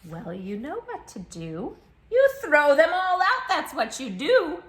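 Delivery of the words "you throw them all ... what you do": loud, triumphant declaration; emphatic answer. A narrator's reading. Reader telling children the answer, loud and triumphant. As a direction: proclaim the answer boldly.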